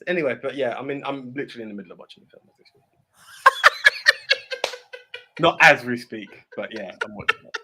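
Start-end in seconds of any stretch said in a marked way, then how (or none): 3.4-5.4 high, loud peal of laughter; big laugh. Real, not acted. Co-host cracking up mid-argument, loud high laughter. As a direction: crack up helplessly.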